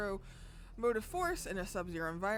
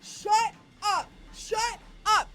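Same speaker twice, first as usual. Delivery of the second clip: disbelieving shout; 12 semitones higher, 10 dB louder, much slower than usual. Real, not acted. Streamer in disbelief.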